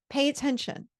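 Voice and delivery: ordinary talk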